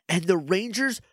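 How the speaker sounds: usual speaking voice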